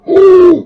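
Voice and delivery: loud, high "ooh"; retold thrilled whoop. Mate marvels at Manu's driving, loud high whoop. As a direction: whoop at the speed, loudly.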